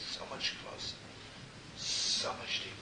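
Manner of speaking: barely voiced, intimate; preacher whispering to his flock; hushed whisper. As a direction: whisper the longing.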